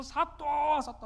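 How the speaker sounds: high, loud call to the magistrate. Acted. 관아에서 사또를 부름. (사또를 큰 소리로 부르기)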